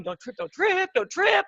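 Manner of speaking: high anxious chant; muttering to herself in panic; high nervous repeating; nervous self-talk. Retold or imitated.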